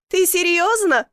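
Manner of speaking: mock hilarity, without real laughing; roaring with laughter. A narrator's reading. Woman laughing at man stuck in chimney, amused. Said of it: "хохотала Василиса".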